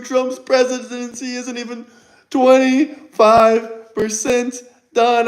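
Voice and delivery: slow, dragged-out whining; mocking a crying critic; mock whiny critic. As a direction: drag out each word like a crybaby. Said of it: "That's how you guys sound".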